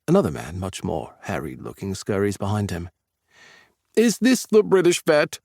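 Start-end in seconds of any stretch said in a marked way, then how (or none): 3.9-5.4 high, loud and eager; bursting to meet the newcomer; exuberant. Acted. Exuberant man asking friends about the new vet, loud and high. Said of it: "the exuberant man asks".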